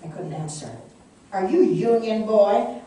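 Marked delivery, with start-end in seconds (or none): 1.3-2.8 loud, slow, mocking jeer. A narrator's reading. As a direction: taunt him slowly and loudly. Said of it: "one jeered".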